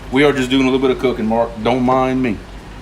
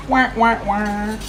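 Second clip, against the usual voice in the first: sad trombone; 8 semitones higher, slower than usual. Pretend.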